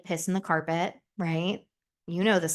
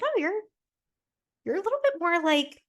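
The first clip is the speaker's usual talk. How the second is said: lightly voiced boyfriend; 10 semitones higher than usual. Voicing her boyfriend's remark.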